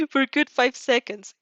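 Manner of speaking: ordinary delivery, nothing marked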